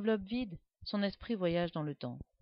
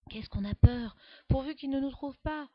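frightened; 7 semitones higher, 9 dB louder than usual. A narrator's reading. Hiding from the guard.

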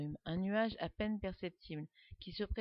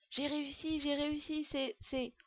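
stunned; 7 semitones higher, slower than usual. A narrator's reading.

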